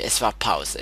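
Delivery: ordinary talk, nothing marked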